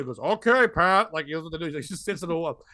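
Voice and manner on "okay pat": lightly put-on old guy, a little louder; odd old man imitation. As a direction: imitate an odd open-mic regular agreeing. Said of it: "He talks like this".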